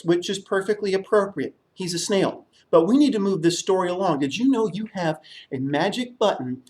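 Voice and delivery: plain reading voice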